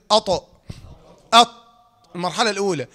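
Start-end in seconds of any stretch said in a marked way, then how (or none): 0.1-1.5 "أط" with the tongue pressed to the palate, loud; demonstrated articulation. Shown as a demonstration. Tajweed teacher shows learners "أط", firm demonstration. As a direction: say "أط" forcefully, tongue sealed to the roof of the mouth. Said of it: "كل كده".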